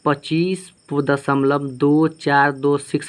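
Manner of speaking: slow, each of "दो चार दो six" separate; digit by digit. Shown as a demonstration. Teacher demonstrating the correct decimal reading, slow and digit by digit. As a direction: stretch out "दो", "चार", "दो", "six" one by one. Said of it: "इसको हम ऐसे पढ़ेंगे".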